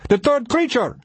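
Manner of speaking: very high, alarmed cry; yelled warning. A narrator's reading. Ari warning of another creature, alarmed yell. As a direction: shout the alarm with pitch way up. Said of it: "Ari yelled".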